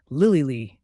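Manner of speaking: contained, narrated yell; screamed name. A narrator's reading. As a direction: call out urgently, short of a full scream. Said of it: "yelled at my beta and my guards while screaming".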